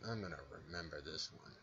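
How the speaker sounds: low, very quiet grudge-holding threat; mock resentment. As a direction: say it under your breath like a pretend grudge. Acts student resenting the stepped foot.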